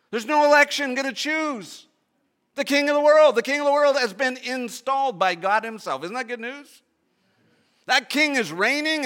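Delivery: loud, high, rising proclamation; preaching at full force. Real, not acted. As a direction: build it to a ringing shout. Preacher building to climax.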